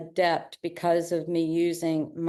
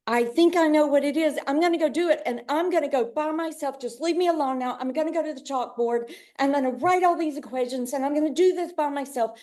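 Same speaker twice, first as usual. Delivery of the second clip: higher, brisk, mock-eager can-do voice; gung-ho go-it-alone voice; 7 semitones higher, faster than usual. Imitating a gung-ho student who insists on working alone, higher and brisk. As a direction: play your stubborn, overeager self who won't take help. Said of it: "we get so gung-ho".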